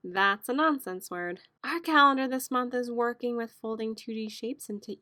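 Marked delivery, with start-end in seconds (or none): none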